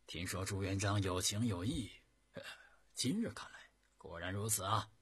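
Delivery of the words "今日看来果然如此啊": usual speaking voice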